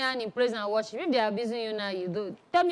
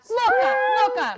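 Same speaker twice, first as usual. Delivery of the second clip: excited catchphrase; 10 semitones higher, 4 dB louder than usual. Acted. Playful shouted catchphrase.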